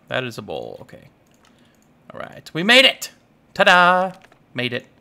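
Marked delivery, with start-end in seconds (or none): 2.5-4.1 triumphant exclamation. Real, not acted. Player finally crafts the bowl, triumphant exclamation. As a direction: show it off with a loud flourish.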